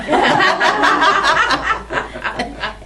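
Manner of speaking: a long high burst of laughter; high loud laugh. Real, not acted. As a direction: laugh at your own joke. Laughing about Columbiana.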